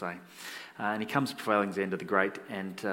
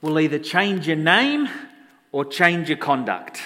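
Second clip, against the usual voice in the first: loud, slow, raised-pitch command; stern ultimatum; 4 semitones higher, 7 dB louder, slower than usual. Acted. Alexander the Great face to face with soldier, stern. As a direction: lay down the choice forcefully and slowly.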